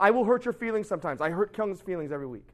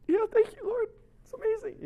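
very high, squeezed weepy praise; mock weepy. Preacher moved by an old man's prayer, mock weepy. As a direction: imitate being moved to tears by a prayer. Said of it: "like, I'm crying".

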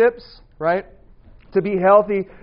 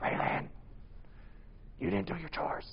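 imitating a father yelling without a voice; hushed, voiceless telling-off; whispered yell; 15 dB quieter than usual. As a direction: scold in a very soft, whispery voice. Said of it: "it doesn't sound like a yell".

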